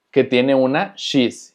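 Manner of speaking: ordinary delivery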